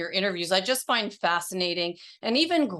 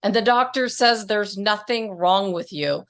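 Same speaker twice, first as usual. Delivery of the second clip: polished, louder announcer-style intro; podcast intro read; 5 dB louder than usual. Acted. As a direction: read it like a show opener. Host reads podcast intro.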